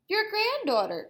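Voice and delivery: put-on high girlish voice; Little Red voice. Little Red at Granny's door, high girlish voice. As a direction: chirp it sweetly.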